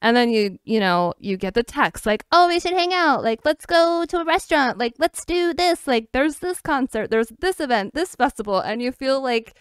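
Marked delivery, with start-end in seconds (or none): 2.3-8.6 high, bubbly imitated invitation; mock eager invite. Friend texting to hang out, mock eager and high. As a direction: ham up a friend's eager texts.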